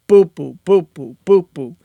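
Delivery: accent the first boop, drop the others. Shown as a demonstration. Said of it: "Kind of like that".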